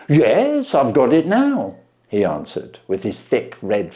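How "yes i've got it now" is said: higher, amazed pawnbroker voice; a plain man dumbfounded by the trick; Wilson voiced.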